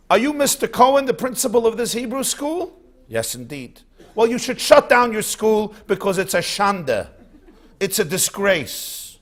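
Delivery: hammed-up angry complaint to the principal; infuriated grandfather. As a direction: demand the school be shut. Infuriated man confronts principal.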